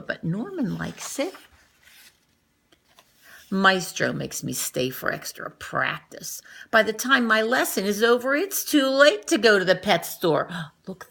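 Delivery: ordinary delivery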